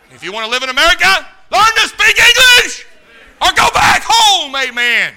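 roaring high shout; preaching shout. Real, not acted. Preacher yelling at the congregation about speaking English, shouting. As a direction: bellow it at the whole church.

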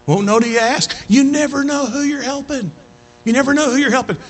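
high-pitched, insistent pulpit refrain; impassioned preaching. Acted. Preacher urging church to keep helping, impassioned.

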